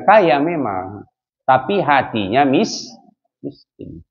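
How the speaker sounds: slow build then whisper the last word; whispered punchline. Acted. Preacher whispering miskin to the congregation. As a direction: drop to a breath on miskin. Same line repeated, whispered ending.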